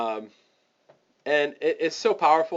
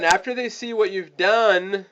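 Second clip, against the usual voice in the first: usual speaking voice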